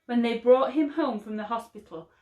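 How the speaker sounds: usual speaking voice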